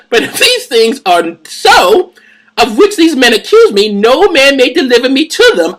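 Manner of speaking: loud-pitched, drawn-out oratory; high theatrical declamation; declaimed scripture reading. Acted. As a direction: proclaim it high and slow like a defendant orating.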